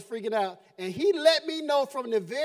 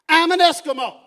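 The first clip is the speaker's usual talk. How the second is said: much louder punchline declaration; loud reveal; 3 semitones higher, 12 dB louder than usual. Retold or imitated. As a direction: blurt the big reveal. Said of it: "and I'm like".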